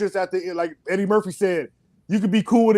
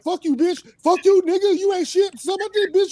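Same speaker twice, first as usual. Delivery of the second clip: hammed-up teenage trash talk, pitched way up; imitating his cussing son; 12 semitones higher than usual. Father mimics his son cussing out his sister, high and brash. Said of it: "he was like".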